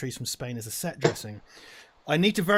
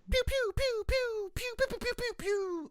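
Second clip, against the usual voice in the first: high laser gun noises; pew pew sound effect; 4 dB quieter, slower than usual. Pretend. Playing interstitial by mouth.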